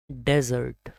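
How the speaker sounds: डेजर्ट starting "DEZ", as in sandy desert; desert pronunciation. Shown as a demonstration. Teacher models the word for arid land, desert pronunciation. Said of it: "डेजर्ट का मतलब होता है रेगिस्तान".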